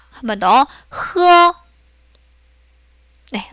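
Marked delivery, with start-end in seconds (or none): 0.9-1.6 흐어 drawn out, high and level; sustained, level 흐어 for learners; Chinese h sound. Shown as a demonstration. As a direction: model 흐어 as the Chinese h, held steady. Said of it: "흐라고 발음합니다".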